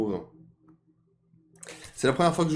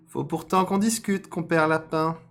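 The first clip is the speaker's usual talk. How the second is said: raised, loud fox character; 9 semitones higher, 6 dB louder than usual. Acted. Fox insisting on a talk with rabbit, raised voice. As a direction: press the point in a high, projected voice. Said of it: "dit le renard".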